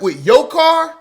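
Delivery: high, loud disbelieving question; shocked question. Retold or imitated. Roommate shocked that he drove his car there, loud and high. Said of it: "he was like".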